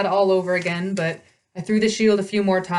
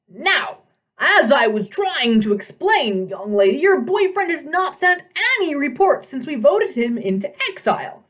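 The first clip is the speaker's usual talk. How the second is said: pompous satyr; 7 semitones higher than usual. A narrator's reading. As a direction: lecture the girl from on high. Old satyr voiced.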